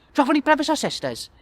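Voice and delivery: high, fast, loud question; rapid-fire child. Acted. Children grilling adult guest, rapid high voice. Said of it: "rapid-fire questions".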